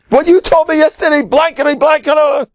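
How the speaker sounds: quick, high-pitched outburst; mock furious accusation. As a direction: fling yesterday's curses back at him. Angry sailor repeats the insult.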